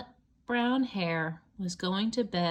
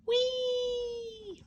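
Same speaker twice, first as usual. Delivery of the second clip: high held whee of delight; gleeful whee. Acted. Reader swinging the bunny up, high whee. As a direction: sail up on a long happy whee.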